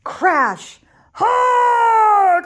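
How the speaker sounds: the giant falls into a hole; giant's crash and shout. Acted. Giant falls in the hole, loud yelling cry. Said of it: "cried the giant".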